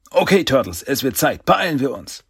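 rally the team to hurry; rallying call. Acted. Aufruf zum Aufbruch. (aufmunternder, hoher Aufruf)

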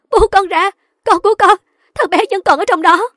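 high, loud desperate cries; frantic mother. A narrator's reading. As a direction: cry out to be let go. Frantic mother struggles toward burning house. (kêu gào thảm thiết)

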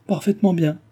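high-pitched, lively retort; exclaiming. A narrator's reading. Milady stung by Cécile's reply, exclaiming. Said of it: "s'écria-t-elle".

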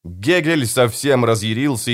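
ordinary delivery, nothing marked

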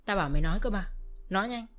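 loud angry bark at the daughter-in-law; father-in-law roaring at his daughter-in-law to speak up; furious yell. Acted. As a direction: yell it, pointing at her face. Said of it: "chỉ vào mặt tôi và quát".

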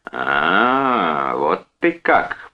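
slow, drawn-out realization; changed tone. A narrator's reading. Brother reacts to the brother-in-law, changing his tone. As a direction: draw it out, turning hostile. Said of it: "меняя тон".

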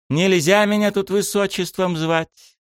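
high, slow indignant princess; high princess voice, mildly offended; cartoon princess. A narrator's reading. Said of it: "тут же отозвалась принцесса". (капризная принцесса, высоко и медленно)

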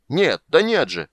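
high, loud, drawn-out no; slow, loud cry of protest; exasperated shout. A narrator's reading. Old man correcting the dragon's course, shouting. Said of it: "кричал старик".

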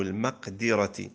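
المقدرة said slowly with "i" after the dal, maqdirati; kasra on the dal. Shown as a demonstration. Teacher listing a word's three vowelings, kasra on the dal of المقدرة. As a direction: sound المقدرة as maq-DI-ra. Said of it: "والكسر والضم".

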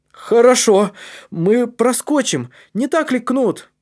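put-on high, loud, excited boy's voice; rallying. A narrator's reading. Калиб rallies Кнут to squeeze through, excited. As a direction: cheer your friend on. Boy rallies friend after good news.

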